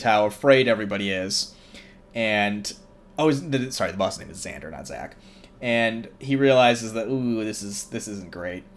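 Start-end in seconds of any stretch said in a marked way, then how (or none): none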